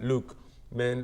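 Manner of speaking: ordinary talk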